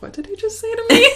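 high, incredulous, half laughing; shocked stranger. Retold or imitated. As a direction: act stunned at the rude remark. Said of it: "and they're like".

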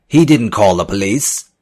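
loud, high accusing shout. A narrator's reading. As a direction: yell it at them. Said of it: "Vargas shouted".

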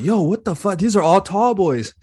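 high, stunned "what the"; can't believe the tall boys; shocked disbelief. Retold or imitated. Friends shocked by the beers.

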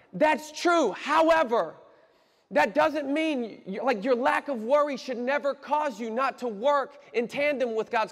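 high, loud, insistent preacher delivery; forceful preaching. Real, not acted. Preacher countering complacent pastors, forceful preaching. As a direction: drive the correction home to the room.

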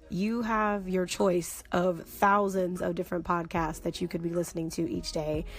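soft, low, measured sign-off; sincere closing blessing. Real, not acted. Host thanking podcast listeners at the end, soft reverent blessing. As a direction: thank them gently and sincerely, like closing a meditation.

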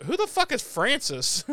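high, incredulous who-the-hell; baffled outburst. Retold or imitated. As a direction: shoot it up in disbelief. Cordy's baffled retort, high pitch.

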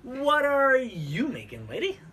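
slow, drawn-out playful host voice; mock announcer question. Host teasing her cohost with a slow, drawn-out question. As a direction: stretch it out like a hammy TV host.

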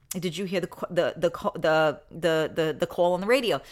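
hesitant, stuttering over the; stammering cop. Retold or imitated. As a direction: trip over the words like an embarrassed cop. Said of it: "The cop said".

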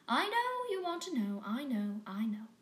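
put-on high whiny kid voice; mimicking eager kittens. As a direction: tease the kittens by copying them.

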